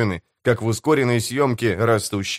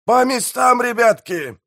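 high-pitched rallying yell; yelling orders; 12 semitones higher than usual. A narrator's reading. Pirate mayor sends crew to their posts, high yell. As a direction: call the crew to action, pitched way up. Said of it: "заорал пиратский мэр".

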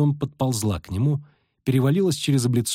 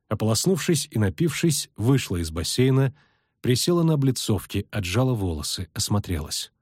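usual speaking voice

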